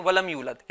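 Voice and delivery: ولم یولد quick, with the یو cut short; hurried, short vowel. Shown as a demonstration. Teacher shows children the mistake, rushed ولم یولد. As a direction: rattle off ولم یولد without lengthening. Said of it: "جلدی پڑھتے ہیں۔".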